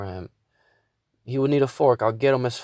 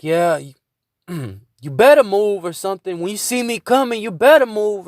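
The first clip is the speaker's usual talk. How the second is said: imitated warning, higher and louder; retold threat; 7 semitones higher, 7 dB louder than usual. Driver warning someone walking in the alley, imitated threat. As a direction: act out a loud confrontational challenge.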